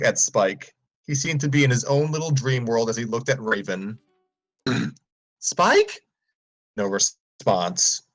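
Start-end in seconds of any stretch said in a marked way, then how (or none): 5.4-6.0 put-on high, girlish call of a name; a young mare calling her friend; Twilight's voice. Twilight calls to Spike in a high voice.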